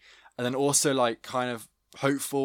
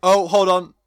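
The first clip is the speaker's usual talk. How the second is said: loud, high burst of excitement; excited, high-pitched interruption; sudden realization; 7 semitones higher, 13 dB louder than usual. Real, not acted. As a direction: cut in loudly as the twist dawns on you. Sudden guess at the plot twist.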